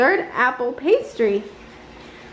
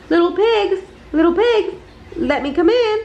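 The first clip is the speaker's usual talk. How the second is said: raised, coaxing wolf voice; wolf calling; 4 semitones higher than usual. A narrator's reading. Wolf calling to the pigs at their door, coaxing. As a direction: call sweetly through the door as the wolf.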